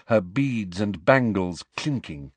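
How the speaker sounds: ordinary delivery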